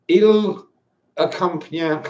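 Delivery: clumsy French accent, feeling out each word; terrible French accent. Shown as a demonstration. Wine drinker reading a French label aloud, terrible French accent. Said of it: "my French accent's terrible".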